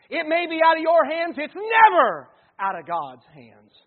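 loud, high, fervent preaching; preaching at full force. Acted. Pastor preaches to the congregation about anxiety, loud and fervent. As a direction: drive the point home to the whole church.

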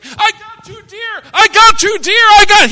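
high excited yell; high, loud triumphant yell. Retold or imitated. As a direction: shout it out, thrilled. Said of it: "I started yelling".

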